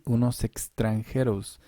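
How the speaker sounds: ordinary talk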